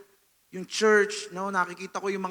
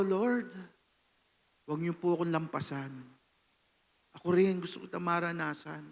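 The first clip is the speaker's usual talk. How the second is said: quiet, humble plea to the Lord; soft pleading prayer; 3 semitones lower, 6 dB quieter than usual. Retold or imitated. Drummer quietly begging the Lord during worship, hushed plea. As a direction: ask softly not to be passed over. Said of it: "Kaya sabi ko".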